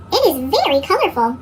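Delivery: usual speaking voice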